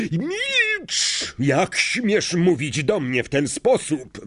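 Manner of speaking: high screech settling into outraged demand; scream. A narrator's reading. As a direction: shriek the first word, then rage. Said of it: "wrzasnął Snape". (wściekły, wysoki wrzask)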